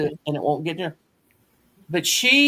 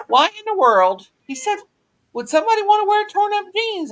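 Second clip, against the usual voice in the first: imitating a baffled father, higher pitch; incredulous dad; 14 semitones higher than usual. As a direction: play dad who can't believe what he's seeing, pitched up. Dad's disbelief, retold.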